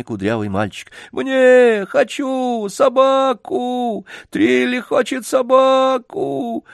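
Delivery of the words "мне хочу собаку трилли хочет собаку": very high wailing tantrum; screaming spoilt child. A narrator's reading. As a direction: howl like a boy stamping his feet. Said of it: "закатывался, топая ногами".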